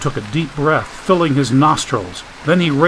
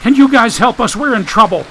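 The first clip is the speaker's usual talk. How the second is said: high, loud, urgent yell; yelling for help; 9 semitones higher, 4 dB louder than usual. A narrator's reading. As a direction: shout it over the roaring storm. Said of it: "he yelled".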